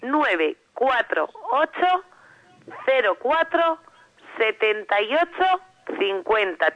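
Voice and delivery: very slow phone number, grouped with clear pauses; slow dictation. Real, not acted. Guest giving radio listeners the number to dial, slow dictation. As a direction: spell the number out slowly so it can be written down. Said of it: "voy a decir el teléfono despacito".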